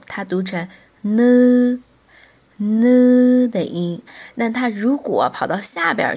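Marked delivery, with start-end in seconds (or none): 1.0-3.5 呢 held long on a level nasal n onset; drawn-out nasal sound. Shown as a demonstration. Korean teacher showing learners the front nasal, 呢 drawn out. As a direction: say 呢 as a long, sustained consonant sound.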